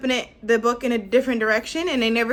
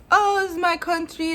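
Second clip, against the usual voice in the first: high, excited broken English, lightly voiced; half-done impression of the neighbour's halting English; lightly imitated older neighbour, higher pitched; imitating the neighbour; 8 semitones higher than usual. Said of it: "really broken English".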